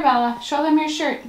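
normal talking voice

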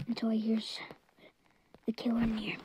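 hushed whisper in hiding. Real, not acted. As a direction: whisper so the killer can't hear. Hiding from killer.

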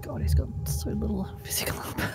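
laughing whisper. Real, not acted. Laughing whisper at weak armor.

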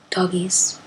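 soft breathy whisper of fondness. Real, not acted. Whispering fondly to her pet dogs, hushed. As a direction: whisper it like a secret crush.

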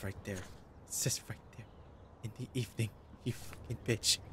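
whispered, voiceless and seething; angry whisper. Real, not acted. Player whispering a seething insult at the game. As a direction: hiss it under your breath.

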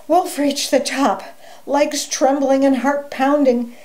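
ordinary delivery

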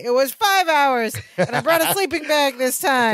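high, indignant mock correction; Gorpley. Shut-out guest correcting the hosts, imitated high. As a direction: pitch it up like calling through a door. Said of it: "then we hear Gorpley say".

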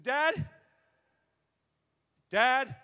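high, loud questioning call; a boy at the bedside calling his sleeping dad, high and loud; calling to wake. Retold or imitated.

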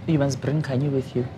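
normal talking voice

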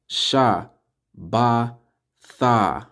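"Sha, Ba, Tha" with a pause after each; syllable by syllable. Shown as a demonstration. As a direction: sound out "Sha", "Ba", "Tha" separately. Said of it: "the A is just at the end".

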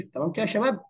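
ordinary talk

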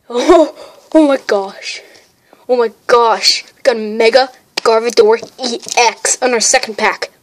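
loud, excited gushing; thrilled amazement. Real, not acted. Card collector telling viewers of the rare pull, loud and thrilled. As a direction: gush loudly over an amazing find.

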